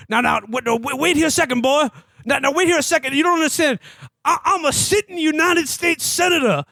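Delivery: high, rising panicky senator; hammed-up frantic plea; mock desperate pleading. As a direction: beg like a cornered senator losing his nerve. Senator pleading before being shot.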